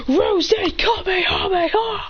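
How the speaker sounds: slow, high shouted attack name. Acted. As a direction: call the attack with full force. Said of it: "Then he says".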